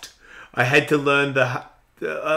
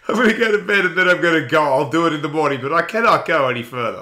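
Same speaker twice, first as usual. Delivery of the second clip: louder, higher, worn-out plea, half laughing; exhausted moan; 4 semitones higher, 6 dB louder than usual. Retold or imitated. Telling his partner he's spent after training, exhausted plea. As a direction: beg off like you've got nothing left. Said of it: "I'd be like".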